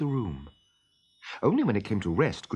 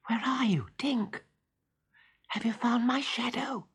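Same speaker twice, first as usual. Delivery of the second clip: a high, unvoiced whisper searching the room; whispered boy's call; 12 semitones higher than usual. A narrator's reading. Peter whispering for Tinker Bell in the dark nursery, high whisper. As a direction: breathe it out high, hunting for the fairy.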